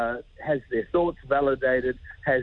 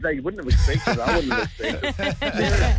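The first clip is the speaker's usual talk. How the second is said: higher, laughing through the words; 5 semitones higher, 3 dB louder than usual. Real, not acted. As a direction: crack up while admitting it. Joking about himself, laughing as he talks.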